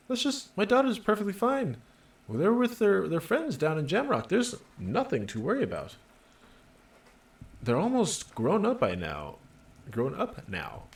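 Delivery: high-pitched, protesting woman's voice; flustered working class mother. Acted. Mother tells policeman her daughters are fine, flustered. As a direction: start high and defensive, easing down.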